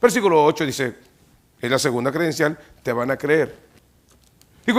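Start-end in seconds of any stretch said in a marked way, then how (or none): none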